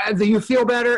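high, sarcastic coddling question; mock patient director. Director humouring an actor after a pointless take, high and sarcastic. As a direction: humour them with a fake kind question. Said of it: "you're like".

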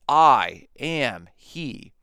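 "I am he" drawn right out and loud; "I", "am", "he", each landing separately with weight; emphatic pronouns. Shown as a demonstration. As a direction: stretch and stress each of the three words "I am he". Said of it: "with the emphatic pronouns".

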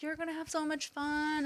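retold motherly cheer, kept quiet; gentle encouragement. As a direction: reassure a nervous child. Mom retells cheering up daughter.

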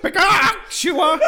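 high mom saying Joshua with a laugh; the mom, laughing through the name. Pretend. Host voices his mom saying his name, high and laughing. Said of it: "I mean".

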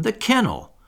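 high, sudden exclamation; excited cry. A narrator's reading. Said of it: "cried John".

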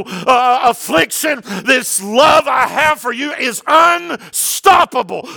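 rising, shouted preaching; preaching at full force. Acted. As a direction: proclaim it to the whole room, building with each line. Preaching God's unstoppable love.

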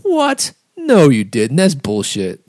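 incredulous high "what", then loud objection; retold challenge, pitch leaping up then settling; imitating wife calling them out. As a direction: call them out in mock disbelief. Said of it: "just called them out".

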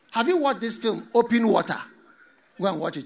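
ordinary delivery

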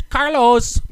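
Carlos with rolled Mexican r, high; thick Mexican accent. Pretend. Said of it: "imagine you have a thick Mexican accent".

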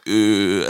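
drawn-out "euh" imitating a French crowd; mock French filler noise; French hesitation "uh". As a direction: parody the droning French "uh". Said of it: "and everybody's going".

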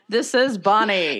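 big ring announcer intro; wrestling announcer. Pretend. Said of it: "I'm saying that like I'm a wrestling announcer".

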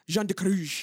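overdone French place-name pronunciation; try-hard French accent. Retold or imitated. Mocking an actor's French place names, try-hard French accent. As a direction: lay the French accent on far too hard. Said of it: "the most try-hard French accent".

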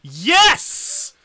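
high, very loud cheer; elated shout. Real, not acted. Friend cheers the wheel landing, elated shout. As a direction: burst out in celebration.

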